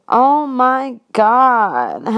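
slow, drawn-out nasal-free Janice. Retold or imitated. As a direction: stretch it out like Janice from Friends. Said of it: "Quote Janice from Friends".

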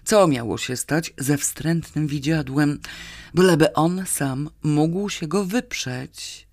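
ordinary delivery

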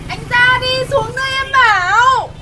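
put-on loud, high calling out to a boy upstairs; shouting up to him. Girl calling up to Nam's house, loud shouting. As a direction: yell it up at the window, lovesick.

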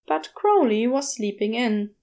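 usual speaking voice

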